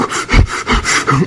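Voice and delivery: quick imitated cigarette puffs; nervous smoking puffs. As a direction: puff rapidly like a jittery smoker.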